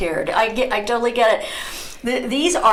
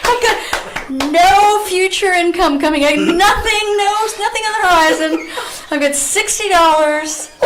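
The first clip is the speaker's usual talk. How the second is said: loud high-pitched disbelief, laughing; loud laughing exasperation; high laughing outburst; 9 semitones higher, 5 dB louder than usual. Real, not acted. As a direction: cry it out through laughter.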